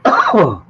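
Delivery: a loud, sharp cough. Real, not acted. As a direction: cough hard into the mic. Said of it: "Sorry for coughing in your ears".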